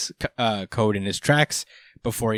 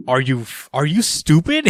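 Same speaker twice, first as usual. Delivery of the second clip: louder, rising mock scold; 5 semitones higher, 4 dB louder than usual. Daemon scolding Code as he charges Amato, mock angry. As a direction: snap at him like he's an idiot. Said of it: "He said".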